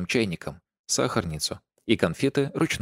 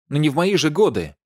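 indignant; 8 semitones higher, 5 dB louder than usual. A narrator's reading. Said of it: "возмутилось её величество".

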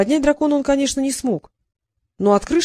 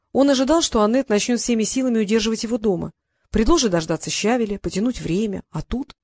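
ordinary delivery

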